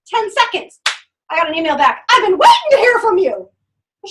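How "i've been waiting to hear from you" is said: high, loud eager reply; mock-eager customer; eager client. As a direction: burst out like she's been waiting.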